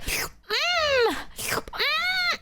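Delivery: high, drawn-out "mm"; exaggerated high hum after a swallow; mimed gulping hum. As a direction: imitate downing shots with squeaky hums. Acts out downing back-to-back shots.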